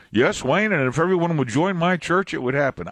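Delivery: mock churchgoer. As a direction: pitch jumps up high in a lightly voiced eager parishioner. Mocking a self-serving churchgoer.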